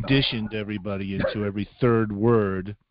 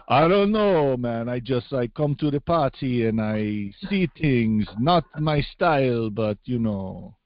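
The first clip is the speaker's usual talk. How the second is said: put-on slow, drawn-out foreign accent drifting toward Transylvanian; laid-back accented character, words stretched out; coconut oil voice; slower than usual. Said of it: "it's an excellent coconut oil voice".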